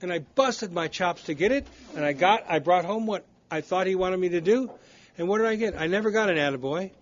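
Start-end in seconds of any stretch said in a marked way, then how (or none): none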